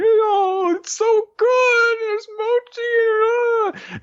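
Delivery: put-on very high, slow, squealing child; delighted little girl. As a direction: swoon over the food like a tiny kid. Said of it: "She's like".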